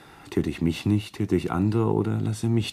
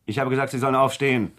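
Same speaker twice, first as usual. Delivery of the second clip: fast, louder repeated order; impatient gunman's command; sharp command; 5 semitones higher, 4 dB louder, faster than usual. Acted.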